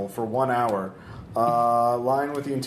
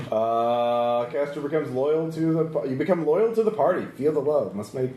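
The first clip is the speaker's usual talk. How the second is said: normal talking voice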